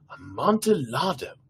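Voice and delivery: lightly voiced tipsy nobleman; musing drunk friend. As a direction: repeat the word as if tasting it. Fortunato repeating the word, voiced.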